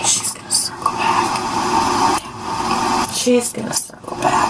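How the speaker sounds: mocking whisper. Real, not acted. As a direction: hiss it under your breath. Mocking the press secretary's phrase.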